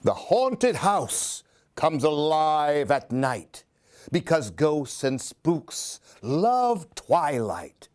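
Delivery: put-on lively read-aloud for a child; expressive children's story reading; storybook narration. A narrator's reading. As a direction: tell it like a bedtime picture book. Reads picture book aloud.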